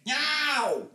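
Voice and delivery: pained yelp. Acted. Pain cry.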